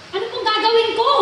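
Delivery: eager new pastor asking what to do; excited. Retold or imitated. Young pastor asking his bishop eagerly, excited and quick. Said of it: "excited na siya".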